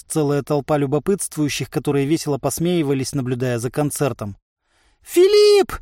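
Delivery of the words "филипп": very high, loud cry; piercing panicked call; desperate call. A narrator's reading. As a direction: shriek a name for help. Merchant calls to Philip for help.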